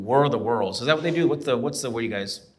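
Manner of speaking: usual speaking voice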